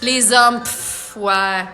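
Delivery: usual speaking voice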